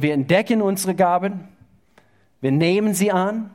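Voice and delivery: usual speaking voice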